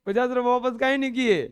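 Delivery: loud mock yell; shouting girl. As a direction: yell it like a fierce little girl. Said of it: "वो एकदम चिल्ला के बोल रही थी".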